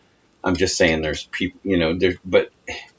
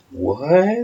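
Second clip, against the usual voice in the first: surprised; 10 semitones higher, 3 dB louder than usual. Retold or imitated. Mock surprised reply to flirting.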